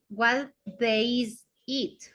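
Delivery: "what day is it?" drawn out very slowly; very slow model of "what day is it?"; slow English model. Shown as a demonstration. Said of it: "¿qué día es hoy?".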